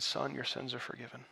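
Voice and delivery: soft, tender pronouncement; hushed. Acted. Jesus speaking gently to the paralyzed man, hushed and tender.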